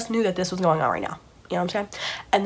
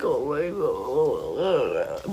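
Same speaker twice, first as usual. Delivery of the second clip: long, wobbling mock wail; fake crying; 5 semitones lower, much slower than usual. Children acting helpless before the woman, fake crying. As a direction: draw it out in pretend helpless sobs. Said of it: "like, crying".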